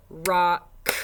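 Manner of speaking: rock said slowly and loud with the K split off; child splitting the word. Retold or imitated. Teacher imitating kids who clap the final sound. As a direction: say rock like a child clapping the K as a syllable. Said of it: "kids might go".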